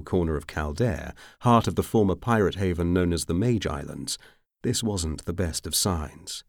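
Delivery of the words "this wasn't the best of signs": usual speaking voice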